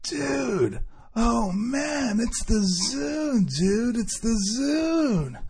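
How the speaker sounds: high, drawn-out sarcastic gushing; mock excitement. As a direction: gush sarcastically in a stretched, high voice. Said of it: "I was looking at it being sarcastic".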